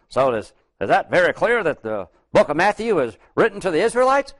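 a late preacher's rising question, lightly voiced; retold preacher question. Recalling the late preacher quizzing the congregation, rising. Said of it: "He said something like".

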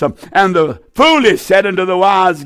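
ordinary talk, nothing marked

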